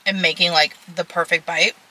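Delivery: speaking between bites; chewing while talking; mouth partly full. Real, not acted. Seen 4: Fork raised to mouth over salad bowl throughout; eating continues before and after.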